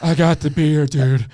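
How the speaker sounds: imitating an out-of-breath runner; panting the words out, winded. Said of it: "He's completely out of breath".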